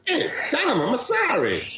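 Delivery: high, insistent Italian father, hammed up; aggressive Italian accent. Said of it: "This time, it's a little more aggressive".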